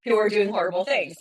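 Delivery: ordinary talk, nothing marked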